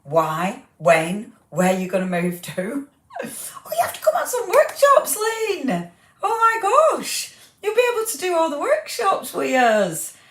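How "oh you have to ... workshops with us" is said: much higher, laughing with excitement; thrilled squeal. Real, not acted. Host squealing with delight to viewer Lynn.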